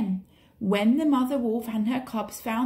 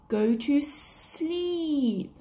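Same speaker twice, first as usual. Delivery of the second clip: very slow, drawn-out hypnotic hiss; evil hypnotic snake; 4 semitones higher, much slower than usual. A narrator's reading. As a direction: stretch every word to lull him. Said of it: "Kaa just smiled an evil smile".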